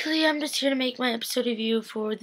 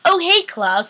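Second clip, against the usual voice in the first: much higher, louder, sing-song hello; mock bright greeting; 9 semitones higher, 7 dB louder, slower than usual. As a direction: greet Klaus with fake delight. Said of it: "they're like".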